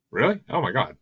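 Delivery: high-pitched, incredulous reaction; can't believe they don't know it; surprised disbelief. Real, not acted. Co-host doesn't know famous song.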